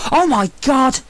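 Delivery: sudden high, loud exclamation; startled shout from under the floor; shocked cry. A narrator's reading. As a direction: recoil at what the torch shows. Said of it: "Matt's voice rose".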